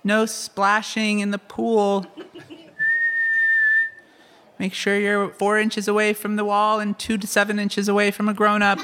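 hint of an intense lifeguard; strict lifeguard. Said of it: "the lifeguard will be really intense with their whistle".

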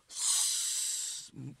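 long unvoiced whoosh, drawn out; hissing whoosh sound. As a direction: breathe out a slow sliding hiss. Miming yolk spreading with spatula.

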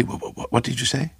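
stammered, hushed start rising high; impatient. A narrator's reading. Craven to his bearded neighbour, stammering and impatient. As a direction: trip over the question impatiently. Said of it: "he asked impatiently".

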